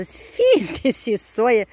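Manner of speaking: drawn-out, very slow gasp of surprise; startled exclamation. A narrator's reading. The commander, stunned by the twins' names, exclaims slowly. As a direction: let the shock stretch every syllable. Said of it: "exclamă comandantul".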